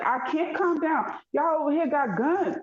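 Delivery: frantic retold plea to the officer, high; retold panic. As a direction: squeal it like you're losing it at the cops. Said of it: "And I was like".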